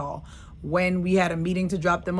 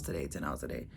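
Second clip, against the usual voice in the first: fading, weary; 10 dB quieter, faster than usual. Shown as a demonstration. Repeated, fading.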